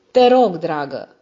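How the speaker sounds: loud, slow call to her husband. A narrator's reading. The wife calling up to her husband, loudly. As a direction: draw out a loud call up the stairs.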